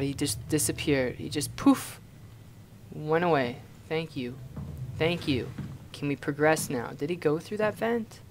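usual speaking voice

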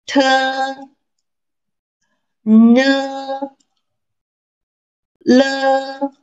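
high, slow, held syllables; teacher drilling sounds. Shown as a demonstration. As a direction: model each syllable for the class. Reads syllables for repetition.

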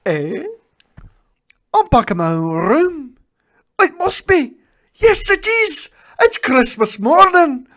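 high, loud overjoyed relief; joyful. Acted. Scrooge waking on Christmas morning, high and loud with joy. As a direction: burst with joy at a second chance.

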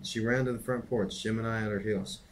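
plain reading voice

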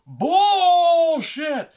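very high, drawn-out mock-furious scream; full-volume hammed-up outrage; pissed-off scream. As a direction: scream it long, imitating an enraged brother. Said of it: "He was so fucking pissed".

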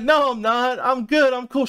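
quick high brush-off, playing it cool; high-pitched denial. He insists to crying friend he's fine, high and dismissive.

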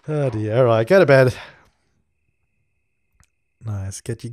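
usual speaking voice

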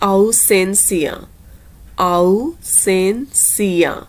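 ausencia stretched very slowly, au-sen-cia; careful slow pronunciation. Shown as a demonstration. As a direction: spell out ausencia by sound for learners. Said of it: "pronunciation ਉੱਪਰ ਧਿਆਨ ਦੇਣਾ". (ausencia ਨੂੰ ਬਹੁਤ ਹੌਲੀ ਤੇ ਸਾਫ਼ ਬੋਲੋ)

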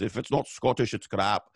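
lightly imitated Scottish accent; a quick, half-hearted Scottish brogue; Mike Myers Scottish accent. Quoting a comedian's famous line, hint of a Scottish accent. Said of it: "Mike Myers in his Scottish accent says".

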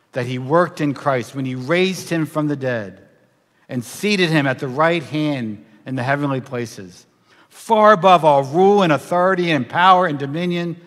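ordinary talk

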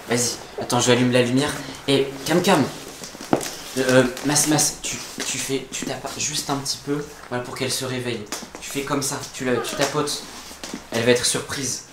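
barely audible whisper. Real, not acted. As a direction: whisper so she doesn't wake. Sneaking into dark bedroom.

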